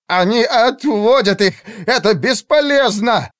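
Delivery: high, anguished wail; despairing moan. A narrator's reading. Mage watches arrows miss, wailing in despair. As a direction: groan that all is lost. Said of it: "простонал Райзер".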